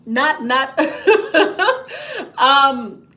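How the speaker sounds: breaks into a laugh mid-sentence; real laugh cutting off the words. Real, not acted. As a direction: giggle taking over the answer. Laughing mid-answer to viewers.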